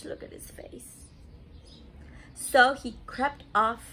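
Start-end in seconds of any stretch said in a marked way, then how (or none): 0.0-1.1 soft whispered aside. Real, not acted. Pointing out Gerald's face to children, whispered.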